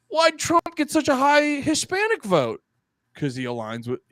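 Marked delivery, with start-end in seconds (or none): 0.1-2.6 very high, whiny mock question; mock bafflement. Imitating strategists puzzled by the vote, high mock bafflement. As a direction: play a clueless, bewildered whiner.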